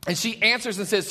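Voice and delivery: usual speaking voice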